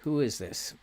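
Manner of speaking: ordinary talk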